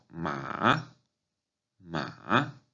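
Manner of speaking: "ma" dipping, then rising; third tone. Shown as a demonstration. Teacher giving the word for horse, "ma" dipping then rising. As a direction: swoop "ma" down and back up. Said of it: "Esta palabra en tercer tono".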